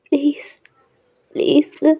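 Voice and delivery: tearful pleading. Acted. Wife begging her husband for time, tearful. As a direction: beg him to wait, close to crying. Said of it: "உங்க கண்ணுல அவ்வளோ tears வருது".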